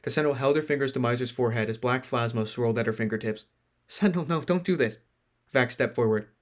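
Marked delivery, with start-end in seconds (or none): 3.9-5.0 pleading. Acted. Pleading friend.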